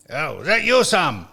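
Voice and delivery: lightly imitated Scottish caller; Scottish accent. Retelling the caller's first words on the phone, mock Scottish accent. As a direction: put on a bit of a Scottish accent. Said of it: "he's Scottish".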